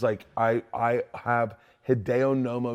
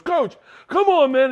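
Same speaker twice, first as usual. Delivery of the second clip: exhausted player plea; 10 dB louder than usual. Retold or imitated. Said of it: "And then he goes".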